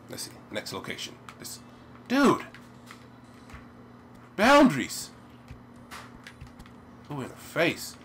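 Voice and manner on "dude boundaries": loud, high exclamation; indignant shout. Real, not acted. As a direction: call out in protest at the game. Protesting at the game.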